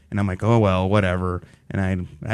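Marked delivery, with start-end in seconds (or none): 0.4-1.4 low, resigned brush-off; dismissive shrug. Retold or imitated. Patient over a lost wisdom tooth, dismissive shrug. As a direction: shrug it off in a lower voice.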